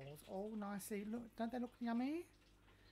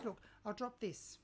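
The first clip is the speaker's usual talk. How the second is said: usual speaking voice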